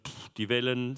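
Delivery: ordinary talk, nothing marked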